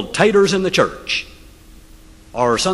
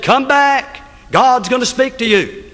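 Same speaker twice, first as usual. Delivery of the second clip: Moses calling; 8 semitones higher, slower than usual. Acted. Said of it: "And Moses said".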